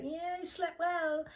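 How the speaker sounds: very high, slow put-on voice; mocking impression. A speaker mimicking Dean in a very high, slow voice. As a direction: stretch it out in a silly high voice. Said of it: "He's like".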